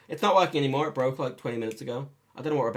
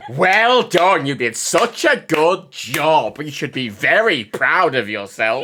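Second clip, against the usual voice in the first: very slow, louder, condescending well done; slow sarcastic praise; 11 dB louder, much slower than usual. Retold or imitated. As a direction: drag out the fake praise like a slow clap. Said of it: "And then you slow clap".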